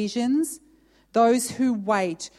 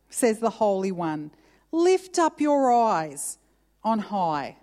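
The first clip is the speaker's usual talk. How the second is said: raised, deliberate Bible reading; scripture reading; 3 semitones higher than usual. Acted. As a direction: proclaim God's words with weight. Reading God's words aloud.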